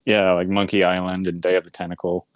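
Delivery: normal talking voice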